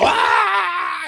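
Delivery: long, high, loud fake scream; drawn-out "aah" of pretend terror; mock choking scream. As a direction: scream as if being strangled, hammed-up. Pretending Elvis is choking them.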